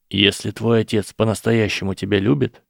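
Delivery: plain reading voice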